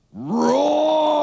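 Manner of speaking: loud, drawn-out roaring word; lion roar. Shown as a demonstration. Teacher showing what a lion does, long roar. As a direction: stretch the word into a big roar. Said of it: "And a lion".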